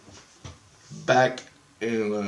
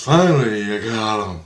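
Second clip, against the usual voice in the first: imitated triumphant cry; 6 dB louder than usual. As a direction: call it out as the rescuer, at long last. Repeats the rescuer's cry.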